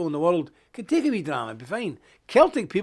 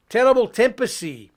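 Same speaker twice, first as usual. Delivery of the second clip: usual speaking voice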